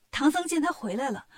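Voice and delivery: ordinary delivery, nothing marked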